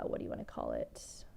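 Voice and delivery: unvoiced, to herself, searching for the word; whispered aside. Real, not acted. Vlogger whispering to herself while searching for a word. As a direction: mutter it under your breath.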